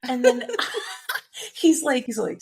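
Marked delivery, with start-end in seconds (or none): 0.5-1.5 high airy giggle; breathy giggle. Real, not acted.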